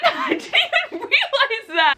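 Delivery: high laughing admission; giggling through the words. Real, not acted. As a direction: laugh at yourself. Laughing at own mistake.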